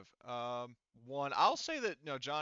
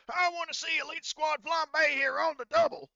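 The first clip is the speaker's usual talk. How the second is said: barked drill sergeant order, much higher and louder; Horgus Hartman drill shout; 15 dB louder than usual. Acted. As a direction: bellow it across the parade ground at the squad. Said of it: "he says".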